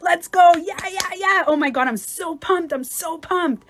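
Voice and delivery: high, loud, breathless-with-excitement gushing; pumped-up hype. Acted. High-frequency people walking into a room, over-pumped cheering. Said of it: "the same thing is up here".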